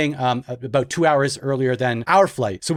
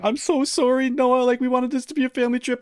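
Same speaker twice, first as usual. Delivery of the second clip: mock tearful apology; 9 semitones higher than usual. Wife crying, voiced retold.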